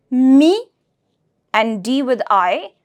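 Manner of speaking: ordinary talk, nothing marked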